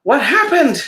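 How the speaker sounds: startled duck after a fall; dazed surprise. Acted. Donald after falling.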